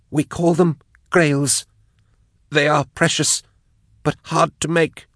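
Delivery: high, strained captive voice; compelled high-pitched testimony; gasping confession. A narrator's reading. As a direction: force out each phrase at high pitch. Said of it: "gasped Jaff".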